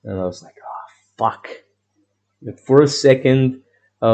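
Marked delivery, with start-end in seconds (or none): 0.6-1.5 whispered curse. Retold or imitated. Quiet dismay at no drink.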